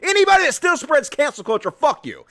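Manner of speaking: loud, high incredulous outburst; worked-up high-pitched shouting; angry rant. Real, not acted. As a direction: yell it in exasperated disbelief. Heated rant at [name] clip.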